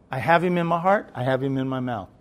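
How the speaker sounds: ordinary talk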